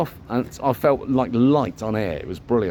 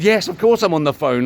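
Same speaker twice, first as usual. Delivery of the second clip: louder, higher irritated retort; cross snap; 6 semitones higher, 5 dB louder than usual. Retold or imitated. To Abby at the car window, a cross loud retort. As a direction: snap back, annoyed. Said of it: "I'm just cross".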